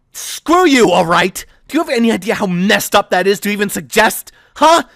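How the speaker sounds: "Screw you" flung out high and loud, then furious questions; angry outburst. Acted. Thea snapping at Aiden and Mia, furious. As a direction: let the anger burst, then press it.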